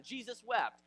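JESUS WEPT high and loud; proud comeback. Retold or imitated. Challenged man proves he knows a verse, proud comeback. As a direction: toss out "Jesus wept" as a triumphant answer.

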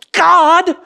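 high, loud cry of "God!"; exasperated cry. Acted. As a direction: shout it to the sky in frustration. Stumbling worshipper cries out.

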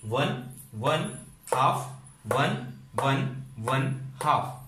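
slowly spelling out each step of the formula; slow formula count. Shown as a demonstration. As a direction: count the steps one by one, spaced out. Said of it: "ये देखिए". (धीरे-धीरे formula गिनते हुए)

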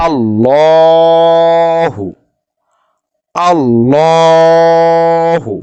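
Allahu chanted slow with the লা drawn out full and heavy; heavy Quranic recitation. Shown as a demonstration. Teacher demonstrating recitation to students, slow heavy chant. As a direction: recite আল্লাহু slowly, the লাম thick like Bengali লয়. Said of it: "যেমন আমি পড়তেছি".

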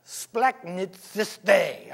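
splachnitsis spelled out very slowly, syllable by syllable; splachnitsis, drawn out as a careful foreign word; Greek word. Shown as a demonstration. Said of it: "try to say that".

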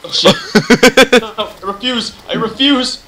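loud frantic refusal; panicked shout. Real, not acted. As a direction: cry out in panic.

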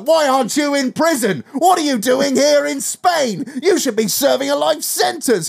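mock doorstep reporter; reporter confronting. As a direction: hound the fugitive. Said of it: "be like".

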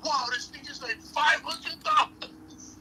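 imitating the seller finding the price on eBay; mock stunned seller. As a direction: ham up the seller's shock at the price. Mocking shop guy checking eBay.

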